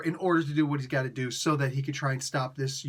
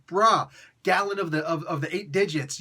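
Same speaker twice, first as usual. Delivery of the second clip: ordinary delivery, nothing marked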